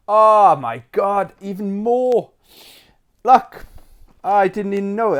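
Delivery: high, loud gasp of delight at the find; nostalgic excitement. Real, not acted. Opening his old Sega catalogue for viewers, excited.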